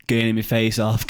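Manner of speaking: normal talking voice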